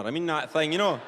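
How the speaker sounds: normal talking voice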